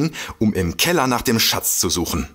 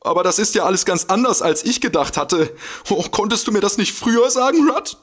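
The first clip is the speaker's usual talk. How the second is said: high, fast flustered complaint; high whiny reproach; 9 semitones higher than usual. Acted. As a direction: whine at him for keeping it secret.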